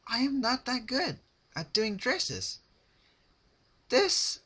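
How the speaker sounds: high, bubbly, sing-song; cheery Pinkie voice. A narrator's reading. Pinkie asking her captive for advice, high and bubbly. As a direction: chirp it like a sweet party pony.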